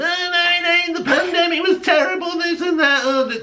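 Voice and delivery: mocking a listener who whines about the pandemic, in a high squeaky put-on voice; mocking whiny objector. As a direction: parody the people who'll object, high and prissy. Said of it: "some of you are out there going".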